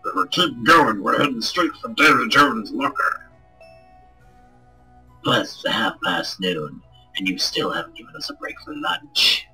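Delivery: grumbling sailor. Acted. Grumbling low crew voice.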